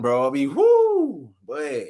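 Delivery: ordinary talk, nothing marked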